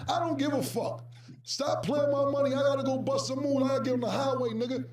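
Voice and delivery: high, pushy, exasperated; exasperated demand. Retold or imitated. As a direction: demand your money, worked up. Cam demanding money from Dame.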